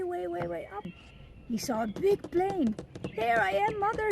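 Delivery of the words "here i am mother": high hopeful call upward. Acted. Baby bird calling up to the plane, high and eager. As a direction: call up to the sky.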